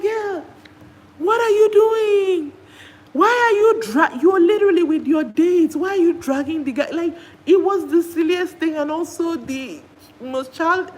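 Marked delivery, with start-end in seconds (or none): 0.0-6.9 high, loud, exasperated yell of disbelief. Retold or imitated. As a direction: shout at her like she's lost her mind. Speaker scolds girl on screen.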